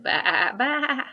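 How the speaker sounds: loud bleating baa, baa; loud sheep bleat. Acted. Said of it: "The sheep said".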